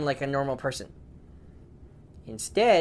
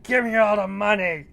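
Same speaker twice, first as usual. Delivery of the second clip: louder, higher bank robber voice; robber's demand; 6 semitones higher, 6 dB louder than usual. Acted. Robber threatening the bank staff, forceful demand.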